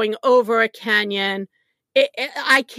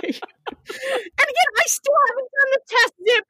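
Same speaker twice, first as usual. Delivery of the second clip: high, fast, half-sobbing laugh; giggling helplessly while telling it, fast; laughing through tears; 12 semitones higher than usual. Real, not acted. Laughing tearfully to her friend at her own panic.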